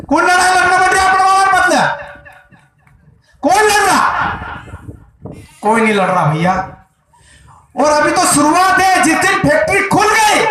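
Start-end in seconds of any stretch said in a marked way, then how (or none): 0.1-4.3 high, ringing call to a crowd; rallying challenge. Real, not acted. सभा को ललकारता सवाल. 7.8-10.4 high-pitched, shouted warning; rally warning. Real, not acted. सभा को चेतावनी.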